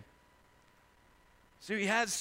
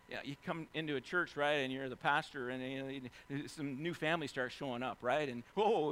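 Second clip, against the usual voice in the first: normal talking voice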